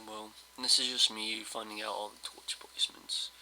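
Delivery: ordinary delivery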